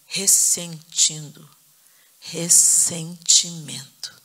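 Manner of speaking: "Ressentindo. Ressentimento." slow, syllable by syllable; drawn-out key word. Shown as a demonstration. Preacher revealing what the Holy Spirit told her, "Ressentimento" slowly drawn out. Breaks word apart to show meaning.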